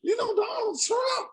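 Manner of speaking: cracking up, voice jumping high; laughing shout. Real, not acted. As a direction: crack up while saying it. Laughing about grandma's news habit.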